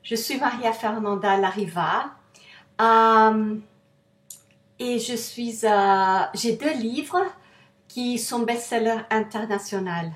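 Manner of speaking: French with a foreign accent; non-native French speaker. Real, not acted. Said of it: "excusez-moi mon français".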